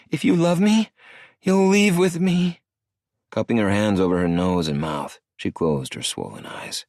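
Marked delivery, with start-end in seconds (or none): 0.1-2.6 put-on high girlish voice, sniffling no; tearful young woman. Star crying, refusing Maddie's misunderstanding, high and tearful. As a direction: shake your head through tears.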